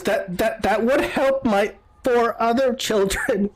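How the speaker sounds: high, halting, plaintive woman; slow, high mock-pitiful voice; grieving mother. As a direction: stammer it out in a high, tearful put-on voice. Grieving mother answers offer of money.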